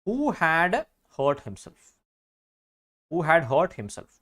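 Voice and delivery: slow, stressed who had hurt himself; slow corrected phrase. Shown as a demonstration. Teacher giving the corrected phrase to the class, slowly. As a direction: spell the fix out slowly. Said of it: "അപ്പോൾ who?".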